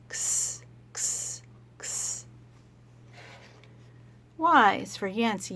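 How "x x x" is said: soft voiceless x sounds; letter sound x. Shown as a demonstration. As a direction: whisper "X" like knitting clicks. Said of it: "he says".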